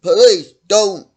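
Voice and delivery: sharp police command, loud and high; cop shouting. Pretend. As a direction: bark it like a cop warning a suspect. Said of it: "the cop could have said".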